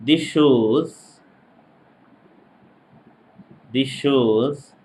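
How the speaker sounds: slow, spaced-out lecture dictation. Real, not acted. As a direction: dictate phrase by phrase for note-takers. Dictating for students to write.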